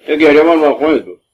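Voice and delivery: tossed off quickly, almost muttered; quick aside. Real, not acted. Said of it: "repeats the line three times". (سریع و آهسته)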